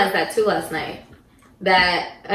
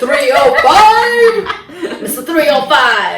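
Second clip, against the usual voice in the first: hype shout; 5 semitones higher, 8 dB louder, much slower than usual. Pretend. Hyping Miami rapper nickname.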